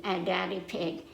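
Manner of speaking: usual speaking voice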